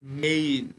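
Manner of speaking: মেইন drawn out with an "ei" glide; demonstrated diphthong. Shown as a demonstration. Teacher models the word for learners, drawn-out মেইন. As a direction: stretch the "ei" in মেইন. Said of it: "এই ওয়ার্ডটার উচ্চারণ হয়ে যাচ্ছে কি".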